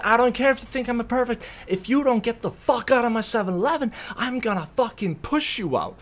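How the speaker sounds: retold heated ultimatum; aggressive. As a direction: get up in their face, retelling the fight. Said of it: "I start getting aggressive up in her face".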